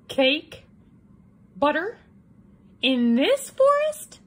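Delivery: high, loud disbelief; surprised. A narrator's reading. Wolf amazed to smell cake in the forest, high surprise. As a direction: gasp at the idea.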